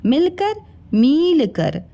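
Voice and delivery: मिलकर short i then मील कर long stretched ee, slowly. Shown as a demonstration. Teacher contrasting the two vowel lengths slowly for kids. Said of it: "ये मी है और ये मी है".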